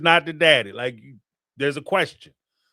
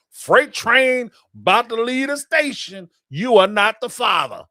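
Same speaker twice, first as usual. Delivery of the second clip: belted out, louder and higher; mock host verdict; 6 semitones higher, 5 dB louder than usual. Host delivering the paternity result to the crowd, hammed-up shout. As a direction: announce it like a triumphant game-show reveal.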